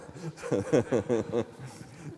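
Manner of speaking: amused laugh at own story; laughing at the mayor's remark; bright laugh. Real, not acted. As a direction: laugh at the punchline.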